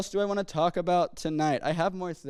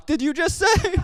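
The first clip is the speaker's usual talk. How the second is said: much higher, louder, caught halfway to a laugh; shocked father; 6 dB louder than usual. Retold or imitated. Father whipping round at his son's answer, stunned and higher. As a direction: whip round in stunned disbelief. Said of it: "He says".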